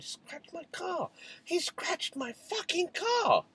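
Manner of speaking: high, slow, strained outburst of fury; furious father telling his pregnant wife the boy scratched his car; livid outburst. Retold or imitated. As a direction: spit it out slowly, beside yourself with rage.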